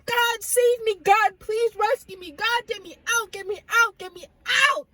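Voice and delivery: high, frantic retold plea; crying out to God. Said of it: "I am crying out to God".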